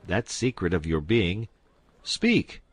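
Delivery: hammed-up theatrical order; grand command. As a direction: build the demand to a peak. Duke commands the confession.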